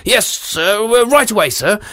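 high eager guard. Acted. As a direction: snap to attention. Guard answers king.

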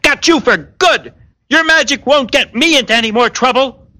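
high-pitched crow of victory; exultant apprentice, voice soaring high; triumphant cry. A narrator's reading. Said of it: "Humboldt cried out".

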